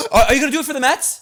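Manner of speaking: high, fast, loud gushing family voice; imitated excited family. Family asking about his first pitch, high, fast, gushing excitement. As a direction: gush like an overeager relative.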